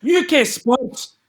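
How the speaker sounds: high, slow Scottish "U K Sports" sign-on; Scottish announcer. Pretend. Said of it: "his name is Ian McGregor".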